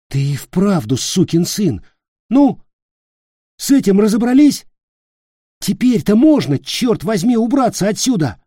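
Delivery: high, loud, exasperated yell; angry yelling. Acted. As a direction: bark at him to get moving. Nick angrily yells at Peter.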